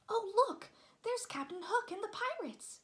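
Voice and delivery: put-on an excited child spotting pirates; excited cry. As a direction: point and shout in delight. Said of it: "cried Michael".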